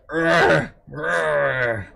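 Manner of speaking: growly little dog grumble; mock dog growl; imitating dog grumbling. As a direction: mimic the dog's grumpy rrr. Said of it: "who is like".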